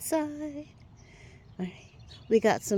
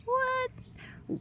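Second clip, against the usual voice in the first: surprised; 4 dB quieter than usual. Acted. Mock surprise at buds.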